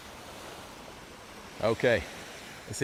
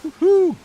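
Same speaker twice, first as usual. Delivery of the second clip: loud cheer; 8 dB louder than usual. Real, not acted.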